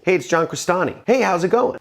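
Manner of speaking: high, needy sales greeting; eager, beta-sounding upward lilt; begging upward slope. Shown as a demonstration. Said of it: "It's begging".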